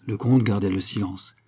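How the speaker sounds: plain reading voice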